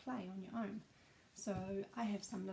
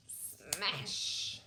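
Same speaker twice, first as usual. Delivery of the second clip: whispered, drawn-out smash; breathy sound-effect smash; crash sound; 4 semitones lower, 4 dB louder than usual. Shown as a demonstration. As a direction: make the crash noise with just breath. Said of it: "There's a great big loud".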